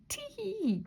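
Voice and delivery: high squeaky tee of glee; very high gleeful chortle. A narrator's reading. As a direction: chuckle to yourself, gleeful. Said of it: "he chortled to himself".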